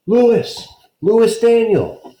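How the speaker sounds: loud, high call across the stadium; father calling for his lost son. Acted. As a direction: call his son's name across the crowd.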